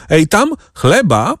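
high, drawn-out call. A narrator's reading. Bandit ordering bread from the boy, calling out. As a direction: call out slowly on a high pitch. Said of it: "krzyknął".

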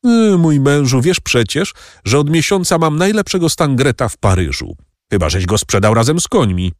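a hint of a scornful baroness whining to her guests; baroness complaining. A narrator's reading.

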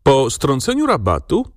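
ordinary delivery

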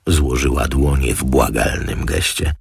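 usual speaking voice

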